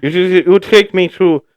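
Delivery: put-on Irish accent with a leprechaun lilt. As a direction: play a cheeky leprechaun. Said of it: "Sounds like a leprechaun".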